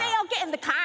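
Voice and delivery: high, loud mock Aussie holler; attempted Aussie accent. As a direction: copy an Aussie yelling for Dale. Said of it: "how to do an Aussie accent".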